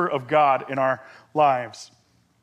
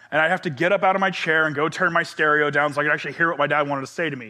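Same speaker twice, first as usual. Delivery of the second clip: normal talking voice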